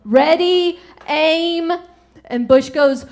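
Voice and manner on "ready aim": lightly acted firing order, pitched up; a hint of a drill-call, raised pitch; executioner's command. Executioner gives the order in joke.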